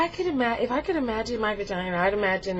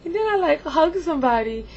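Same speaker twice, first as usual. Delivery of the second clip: usual speaking voice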